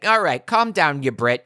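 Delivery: ordinary talk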